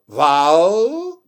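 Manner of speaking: VOWEL held long, gliding up into the L; drawn-out final L. Shown as a demonstration. Teacher pointing out the L to learners, drawn-out Vowel. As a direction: stretch Vowel and let the L ring at the end. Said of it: "olha o L".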